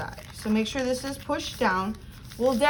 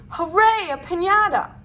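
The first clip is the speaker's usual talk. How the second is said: excited; 6 semitones higher, 6 dB louder than usual. Acted. Teacher cheering to kids.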